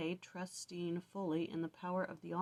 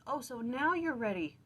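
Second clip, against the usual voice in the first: sarcastic, higher and louder jab; mocking Joseph; 6 semitones higher, 6 dB louder than usual. Joseph taunting his brothers, sarcastic and louder. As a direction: needle them with scorn.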